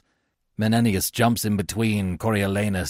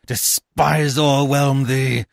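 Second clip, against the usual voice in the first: slow, drawn-out theatrical contempt; scornful outburst; 5 semitones higher, 5 dB louder, much slower than usual. A narrator's reading. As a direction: heap scorn on a hated opponent. Coriolanus insults the tribune.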